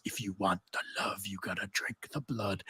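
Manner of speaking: breathy whisper of a rap hook; whispered chorus. Shown as a demonstration. Reader quoting the Bacchae rap chorus, whispering it. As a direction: whisper the chant like a secret. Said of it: "the chorus is".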